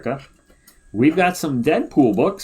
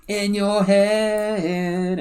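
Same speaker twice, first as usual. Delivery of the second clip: drawn-out joke; 9 semitones higher, much slower than usual. Pretend.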